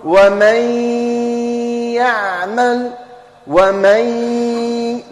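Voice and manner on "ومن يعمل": "ومن يعمل" read with a long held nasal ghunna on the merged n; nasal merge demo. Shown as a demonstration. Teacher showing pupils how to read it, with a long ghunna. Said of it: "كيف أقرأها".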